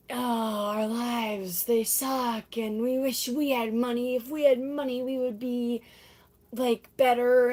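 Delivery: drawn-out, half-sung group moan; mock lament. The cast wishing they had money, mock lamenting. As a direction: moan it lightly like a musical chorus.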